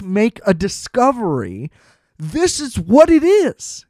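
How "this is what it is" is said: high, slow, emphatic protest; exasperated outburst. Real, not acted. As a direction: hammer it home in exasperation. Exasperated rant at outraged viewers.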